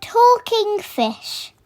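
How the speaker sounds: ordinary delivery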